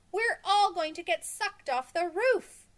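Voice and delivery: high, loud panicked cry; screaming. A narrator's reading. Girl screams to classmates about the tornado, high and loud. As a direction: scream it to everyone on the roof. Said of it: "she screamed".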